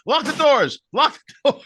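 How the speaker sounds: high, loud mock alarm; retold panicked call. Richie runs around the room, retold panicked call. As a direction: call out as if clearing for trouble, amused. Club owner running round the room shouting.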